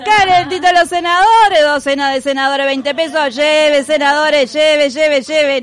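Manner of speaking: loud market vendor hawking wares; hyped fairground barker. Pretend. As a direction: shout it like a street seller. Novik selling senators like a market vendor.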